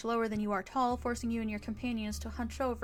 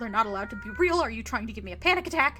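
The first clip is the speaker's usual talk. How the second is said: louder, rising into panic; panicked denial; 7 dB louder than usual. Acted. Nervous friend rejecting the Tommyknockers idea, frantic. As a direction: insist they aren't real, frantic.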